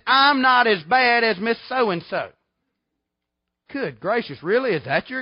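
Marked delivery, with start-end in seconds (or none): 0.1-2.3 loud, slow, drawn-out mocking of a lame excuse. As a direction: stretch "I'm" out loud and slow like a silly excuse. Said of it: "Just like the one that says".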